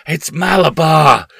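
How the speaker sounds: powerful strange scream. Acted. Boy on the rocking horse screaming at his mother, powerful and strange. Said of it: "he screamed in a powerful, strange voice".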